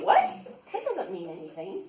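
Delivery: usual speaking voice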